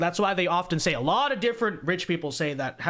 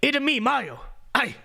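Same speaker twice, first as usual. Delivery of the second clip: hop into it like Japanese Mario; higher excited cartoon plumber cry; 7 semitones higher than usual. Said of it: "what he sounds like in Japan".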